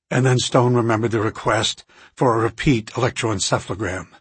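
ordinary delivery, nothing marked

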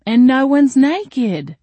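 very slow, high, loud gush; sighing delight. A narrator's reading. Guest of honor marvels at the baby shower, sighing delightedly. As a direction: draw it out with blissful amazement. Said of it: "Jolene sighed again".

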